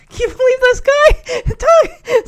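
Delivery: high-pitched mock scoff; scoffing disbelief. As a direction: scoff it off with a nervous laugh. [name] scoffs at the accusation.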